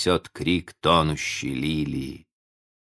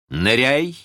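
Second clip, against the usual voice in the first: sharp command; 7 semitones higher, 3 dB louder than usual. A narrator's reading. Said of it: "Резко и чётко прозвучал его приказ".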